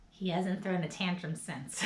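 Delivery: usual speaking voice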